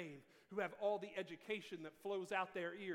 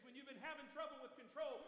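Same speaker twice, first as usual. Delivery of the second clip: usual speaking voice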